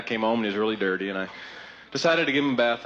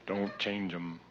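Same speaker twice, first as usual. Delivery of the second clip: soft demo; 4 semitones lower, 11 dB quieter, much slower than usual. Shown as a demonstration. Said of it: "It's very emphatic".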